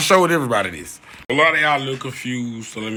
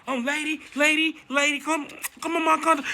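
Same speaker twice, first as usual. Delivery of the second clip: high sweet coaxing call, much higher; coaxing a dog; 13 semitones higher, 6 dB quieter than usual. Retold or imitated. Coaxing the loose dog back onto the counter, high and sweet. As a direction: sweet-talk the dog toward you, pitched way up. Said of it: "So I'm saying".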